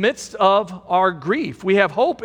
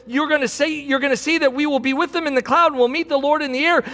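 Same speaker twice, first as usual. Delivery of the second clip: ordinary talk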